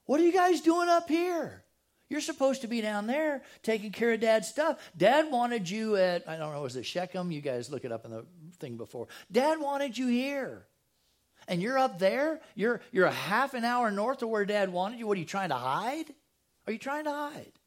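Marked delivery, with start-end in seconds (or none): 0.1-6.3 high, loud mock telling-off; acted scolding. Joseph finding his brothers away from where Dad wanted, scolding. 9.3-17.5 high-pitched accusing tattletale act; acted scolding. Joseph accusing brothers of hiding from Dad's errand, scolding.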